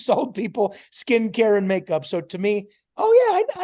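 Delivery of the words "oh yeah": high, loud know-it-all reply; eager recognition. Retold or imitated. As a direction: jump in as if you know it already. Acts out his confident younger self.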